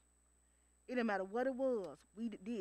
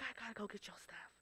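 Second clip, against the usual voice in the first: whispered under the breath; quiet whispered aside to herself; 11 dB quieter than usual. Retold or imitated. Answering dad about the mangoes.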